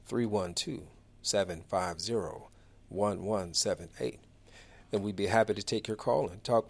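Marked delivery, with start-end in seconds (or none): 0.1-4.2 slower, lower and softer, digits in separate groups; slower repeat. Real, not acted. Radio host repeats the phone number for listeners, slower and deliberate. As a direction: repeat the number carefully, pausing between groups. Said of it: "Did I say that too fast?".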